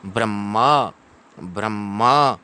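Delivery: "ब्रह्म" with a held double m; slow, deliberate "ब्रह्म" ending on an open a; pronunciation model. Shown as a demonstration. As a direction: model "ब्रह्म" as bram-ma for repetition. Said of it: "पुनः उच्चारण करें".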